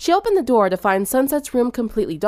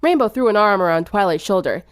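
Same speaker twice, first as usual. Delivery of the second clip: plain reading voice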